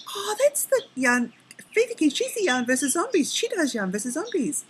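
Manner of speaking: high mock chatter of a friend; imitating Laura. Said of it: "and then she goes".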